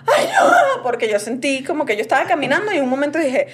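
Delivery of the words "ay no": high mock cry of pain; retold scream. Host retells screaming when her legs froze, high yelp. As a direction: yelp it out as if pain hit suddenly. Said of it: "Y yo pego un grito como".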